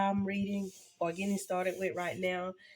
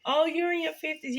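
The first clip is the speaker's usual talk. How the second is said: mock surprise; 8 semitones higher than usual. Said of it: "I know sometimes people will say".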